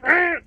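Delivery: high, loud frustrated "ah!"; sudden exasperated outburst; frustrated cry. Acted. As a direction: yelp of frustration. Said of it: "You hear her getting frustrated".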